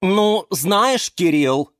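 offended plants retort; indignant. A narrator's reading. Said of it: "возмутились".

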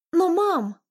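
high whining protest; whiny girl. Acted. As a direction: plead like a sulky teen. Daughter pleading with mother.

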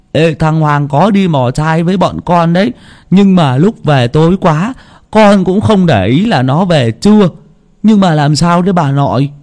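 put-on a boy confused by the question; lightly voiced young grandson; puzzled boy. A narrator's reading.

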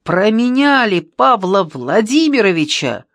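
loud, drawn-out indignant accusation; heated, emphatic reproach; angry shout. A narrator's reading. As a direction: accuse them with scornful anger. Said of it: "сердито крикнул Ящиков".

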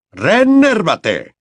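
high, loud, very slow incantation; spell incantation. Acted. Mr Diggory casting a reviving spell on Winky. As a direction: draw out the spell word.

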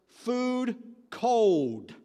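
lightly acted monk, loud, drawn-out words; terse monk. Monk breaking a year of silence with two words, terse. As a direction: announce two allowed words, each standing alone.

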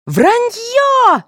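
very high outraged cry; shouting. A narrator's reading. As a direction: yell it in outrage. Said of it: "закричала девочка".

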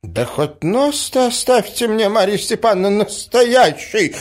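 put-on high-pitched pleading old prince; stunned outcry. A narrator's reading. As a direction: cry out helplessly, overwhelmed. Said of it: "вскричал князь, ошеломлённый такими внезапными откровенностями". (ошеломлённо, жалобно вскричать)

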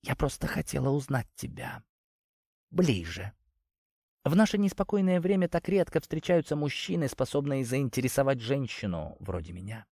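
soft, close femme fatale murmur; seductive whisper. Acted. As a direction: seduce him in a hush.

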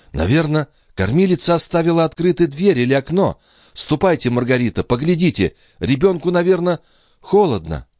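high-pitched, fretting lady; anxious young mother. Acted. Andrea sending Margarita to check the child, high and anxious.

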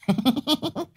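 high, pealing giggle; cackling laugh. A narrator's reading. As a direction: cackle high in the lisping wife's voice. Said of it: "Thị cười khanh khách". (cười khanh khách)

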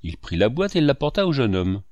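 plain reading voice

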